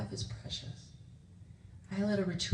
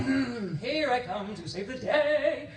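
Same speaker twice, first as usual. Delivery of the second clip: slow, drawn-out heroic fanfare with higher pitch; mock superhero; 6 semitones higher, 4 dB louder, slower than usual. Parent rushing in to fix things for the kids, mock superhero. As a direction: announce yourself as the comic rescuer. Playing rescuer for her kids.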